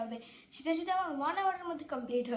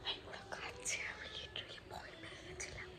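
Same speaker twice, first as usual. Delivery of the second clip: frightened hushed whisper; scared whisper; 2 semitones higher than usual. Real, not acted. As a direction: whisper tensely, afraid of discovery. Hiding in fear, says scared.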